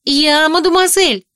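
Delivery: high, loud outraged cry; indignant shout. A narrator's reading. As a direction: shout it back, offended. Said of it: "возмущённо выкрикнула Китти".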